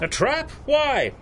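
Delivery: high mocking incredulity; scoffing disbelief. Chief mocking his constable's fear, high and scoffing. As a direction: scoff at the idea.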